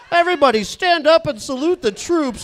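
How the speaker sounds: high, loud mock announcement; stadium announcer call. Announcer to the crowd at a Diamondbacks game, booming call. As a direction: call out to the whole ballpark.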